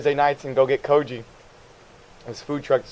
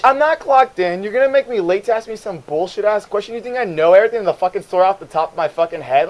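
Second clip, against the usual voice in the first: raised-pitch indignant tirade; exasperated rant; 5 semitones higher, 3 dB louder than usual. Retold or imitated. Clerk not yet clocked in to a customer, indignant and high. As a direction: tear into the customer in a retold rant.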